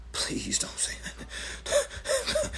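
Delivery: breathy whisper, slow and pleading; whispered plea. Pretend. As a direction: whisper it as if afraid to be heard. Repeat of the plea.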